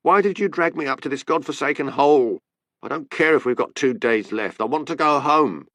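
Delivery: an English wife fed up, shouting at her husband; angry English accent, shouting; angry English tourist. A narrator's reading. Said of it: "Her accent was English".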